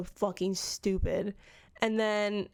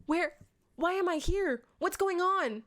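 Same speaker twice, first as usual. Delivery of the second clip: fake confused; 8 semitones higher than usual. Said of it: "she's like".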